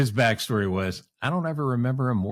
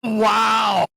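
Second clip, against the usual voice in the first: loud, much higher wow of shock; 9 semitones higher, 5 dB louder than usual. Real, not acted. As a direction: gasp it out in real disbelief. Reacting to bib catching fire.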